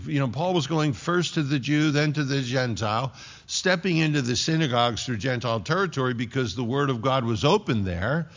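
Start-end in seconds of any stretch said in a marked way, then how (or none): none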